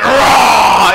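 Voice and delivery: loud comic roar; big mock-scary "raaah"; monster roar. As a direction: roar like a monster to scare a kid.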